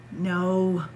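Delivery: plain reading voice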